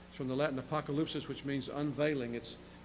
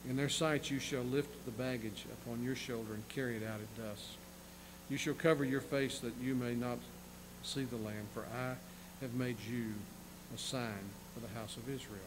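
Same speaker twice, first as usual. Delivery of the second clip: softer reading of the verse; hushed scripture reading; 5 dB quieter than usual. Acted. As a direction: read it quietly to close.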